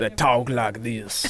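put-on slow southern vampire accent. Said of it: "that's a southern vampire accent".

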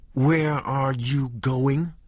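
high, slow, frightened wife's question; alarmed. A narrator's reading. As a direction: ask it in sudden alarm. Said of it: "Vicky asked with alarm".

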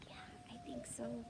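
high, fast whisper. Acted. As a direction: hiss it like a nervous secret. Reader answers scared question.

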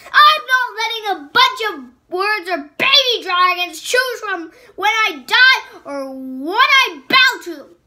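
high, loud furious queen; furious outburst. Acted. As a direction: rage at him, cut him off. Queen's defiant rant.

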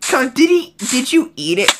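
sudden high alarmed squeal; shocked. Real, not acted. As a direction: yelp in disbelief. Item gone, shocked at mob.

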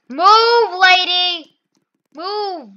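high, loud order to get moving; impatient yell. Real, not acted. Gamer to a lady blocking the line, impatient yell. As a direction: call out sharply, exasperated.

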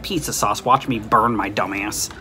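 usual speaking voice

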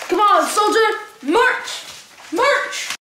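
shouted army commands, hammed up; mock drill sergeant. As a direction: play the drill sergeant marching a recruit. Drill sergeant ordering Cam to march.

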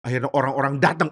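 usual speaking voice

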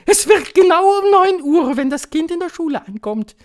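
very high, loud witch proclamation; victorious high-pitched shout; triumphant witch. A narrator's reading. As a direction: announce it triumphantly at the top of the register. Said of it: "rief die Hoch- und Großmeisterhexe triumphierend".

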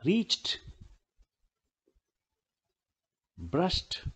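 "रीच्ड", "ब्रश्ड" ending "cht" and "sht". Shown as a demonstration. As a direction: say "रीच्ड" and "ब्रश्ड" as single syllables closing on t. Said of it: "ट बोला जा रहा है आखिरी".

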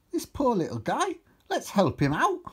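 high, concerned character voice; pitying character. A narrator's reading. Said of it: "Steve stated".

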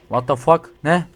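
normal talking voice

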